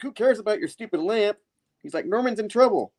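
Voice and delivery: high-pitched mock exasperation; exasperated retort. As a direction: brush it off in a high voice. Peter snaps back at Jameson.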